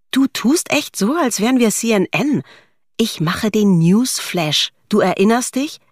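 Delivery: high-pitched, incredulous comeback; mocking retort. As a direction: needle the boss for overreacting. Nadine mocks boss's self-importance.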